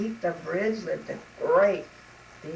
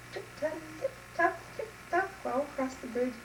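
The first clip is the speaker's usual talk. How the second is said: very high, light little steps; dainty high-pitched footfalls; tiny tiptoe steps; 7 semitones higher, 4 dB quieter than usual. Acted. Little goat's light steps on bridge.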